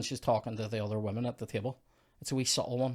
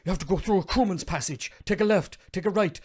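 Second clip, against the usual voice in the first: high, loud recital of directions; hammed-up list of urgent directions; Mister Andrews; 7 semitones higher, 7 dB louder than usual. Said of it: "Mister Andrews goes".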